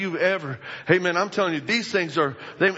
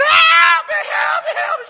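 shrill, very high scream for help; 10 dB louder than usual. Acted. Damsel tied on the tracks screams for help. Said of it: "She's screaming".

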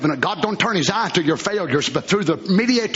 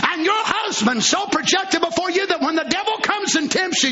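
high, shouted revival preaching; preaching at full force; 12 semitones higher than usual. Acted. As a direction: hammer it out from the pulpit like a revival. Preacher voicing the tempter.

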